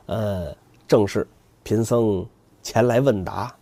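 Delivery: low, slow and meek; bow your head and ask gently; soft humble monk. Acted. 唐僧恭敬求问答. (低声恭敬的僧人)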